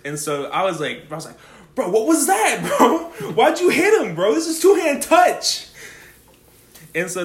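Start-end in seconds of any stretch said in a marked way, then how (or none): 1.7-5.7 high, loud, half-laughing protest; laughing outrage. Retold or imitated. Narrator to Jackson after the hit, a loud laughing protest. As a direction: call him out, giggling with disbelief.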